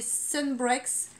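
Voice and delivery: Sunbreaks said slowly with an English accent; playful English accent on the brand name Sunbreaks. Shown as a demonstration. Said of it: "Et ouais, l'accent anglais".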